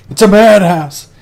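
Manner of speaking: high, loud movie-quote outburst; mock frantic cry. Man in Planet of the Apes, mock frantic shout. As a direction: cry it out in hammed-up despair.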